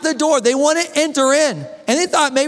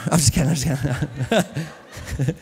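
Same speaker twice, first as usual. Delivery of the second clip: laughing; 8 semitones lower, 5 dB quieter than usual. Real, not acted. Joking to the congregation.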